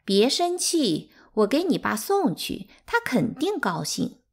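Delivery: sly teasing voice; mocking. A narrator's reading. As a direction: fake soothing, sing it out high to needle them. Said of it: "桃花怪笑着拿起一个月饼盒说".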